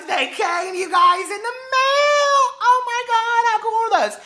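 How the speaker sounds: high-pitched loud squeal of joy, slowed on the mail; excited squeal. Real, not acted. Squealing over sunglasses in mail.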